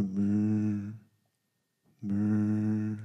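low buzzing alarm-clock imitation, soft. Poet imitating his eight o'clock alarm clock, low buzz. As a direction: buzz the lips like a morning alarm.

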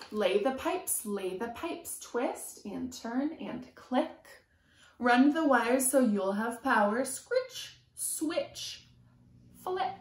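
lively sing-song picture book reading; reading to children. A narrator's reading. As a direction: chant the rhyme to a small child. Reading rhyming picture book aloud.

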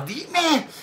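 high, sharp mock of mom yelling; mother demanding. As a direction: imitate mom barking her question. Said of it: "hagan de cuenta que mi mamá".

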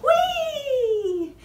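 high, loud mouse squeal. Acted.

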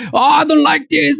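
high whiny huff of someone throwing a fit; chucking a fit. Acted. Preacher mocks a believer who grumbles at God, whiny huff. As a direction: sulk it out like a spoilt child. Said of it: "and chucking a fit".

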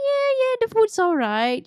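high, offhand voice pretending not to care; playing it cool. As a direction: act unimpressed, lightly. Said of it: "trying to play it cool".

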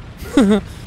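short high laugh; quick amused laugh. Real, not acted. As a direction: burst out laughing. Laughing at enemies' fate.

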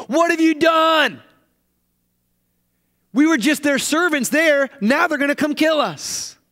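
high, loud, panicked accusation; frightened crowd shouting at their leader; panicked cry. Acted. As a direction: cry out at Moses in terror, pitched high and loud. Frightened people shouting at Moses.

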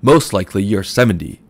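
loud mocking guess; loud jeer. A narrator's reading. Shopman taunts Mooney about his age, loud jeer. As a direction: taunt him before the customers.

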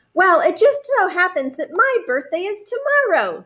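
high, animated bear voice; storybook Bear. A narrator's reading. Bear tells the moon about his birthday, high character voice. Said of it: "said Bear".

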